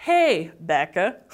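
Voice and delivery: Hey, Becca drawn out slowly like a greeting; Hey Becca mishearing. Retold or imitated. Teacher recalling mishearing Brazilians say Rebecca, slow greeting. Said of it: "eu achei que todo mundo tava falando".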